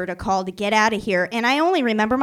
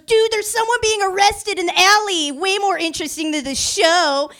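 higher, eager onlooker voice; excited gossip; 8 semitones higher than usual. Retold or imitated. Neighbours calling each other out, eager and higher. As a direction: relish the drama. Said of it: "Everybody comes out and goes".

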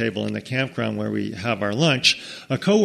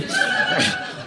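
put-on breathy boy laugh. Boys laughing at stuck Josh.

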